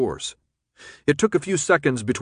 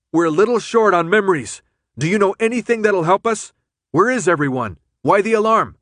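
raised, higher urgent voice; speaking loudly over noise; 8 semitones higher, 6 dB louder than usual. A narrator's reading. Thomas questioning Brenda over the din, loudly. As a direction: project over a blaring alarm. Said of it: "speaking loudly to be heard over the noise".

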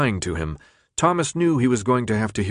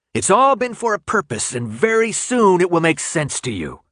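rising to a near shout, much higher and louder; 8 semitones higher, 4 dB louder than usual. A narrator's reading. Ratman losing his temper at Thomas, near shout. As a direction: build it until you're practically shouting. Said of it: "he'd practically shouted that last word".

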